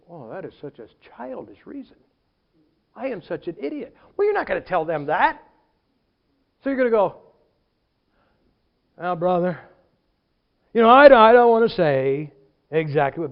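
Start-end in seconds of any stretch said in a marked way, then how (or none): 0.1-4.0 soft, acted self-reproach; private chagrin. Former member thinking back on leaving, quiet chagrin. 9.0-13.3 mock reluctance. Former member to a brother, mock reluctant hedging. Acted evasive excuse to church brother.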